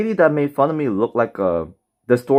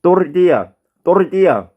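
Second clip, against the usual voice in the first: put-on Tortilla with a tapped r, Mexican accent; fake Mexican accent; 7 semitones higher, 5 dB louder than usual. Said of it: "Fake, uh, Mexican accent".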